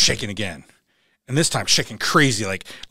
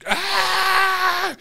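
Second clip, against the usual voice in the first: high, sustained scream; imitated terrified scream; 6 dB louder than usual. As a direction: scream as if in free fall. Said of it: "Screaming at the top of my lungs".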